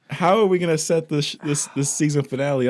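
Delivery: high, loud mock outrage. Real, not acted. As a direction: play up the disbelief.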